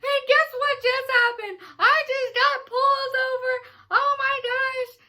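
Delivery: high, loud panicked wail; freaking out. Retold or imitated. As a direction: shriek it out, near tears. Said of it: "I was like".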